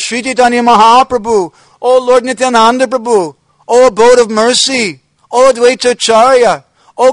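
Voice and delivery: high, loud, anguished crying out to the lost saints; retold wailing lament. Teller voices the saint crying out for departed devotees, loud anguished wail. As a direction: plead into the emptiness, voice straining with grief. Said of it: "he began to sob and cry out".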